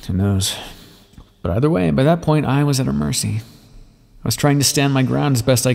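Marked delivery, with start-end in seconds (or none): none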